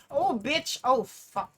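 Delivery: loud startled swearing; loud cursing. Real, not acted. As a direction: blurt it out loud. Gamer curses at game event.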